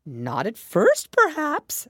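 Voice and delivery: loud, drawn-out Hatter; conceding Hatter. A narrator's reading. Hatter answering Alice's objection, conceding. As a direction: concede it loudly and slowly.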